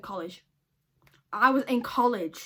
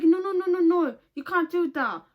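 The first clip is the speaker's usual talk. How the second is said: higher, louder mock chef, slowing at the end; hammed-up alarmed chef, pitched up; head chef's protest; 7 semitones higher, 6 dB louder than usual. Head chef stopping him from binning the stock, higher and louder.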